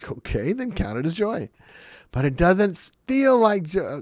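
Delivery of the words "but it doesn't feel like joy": high, loud, slow complaint; whiny objection. Retold or imitated. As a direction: drag out the whine. Voicing a reluctant listener.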